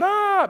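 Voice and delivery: high, loud "aah" imitating a frightened child; hammed-up kid's scream; mock crying wail. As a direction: wail like a scared little boy. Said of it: "he's crying, going".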